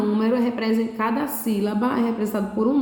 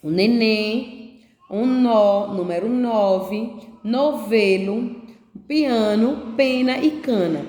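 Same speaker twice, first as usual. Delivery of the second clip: put-on each word drawn out slowly for children; name each picture slowly and clearly; slow, clear teacher naming of "neném", "nó", "nove", "novelo"; slower than usual. Shown as a demonstration. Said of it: "aqui eu tenho o quê?".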